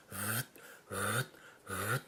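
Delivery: "Vut" voiced low and soft, repeated three times; voiced vut syllables. Shown as a demonstration. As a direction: say "vut, vut, vut" softly into the call. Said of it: "I voice the word "vut" into the call".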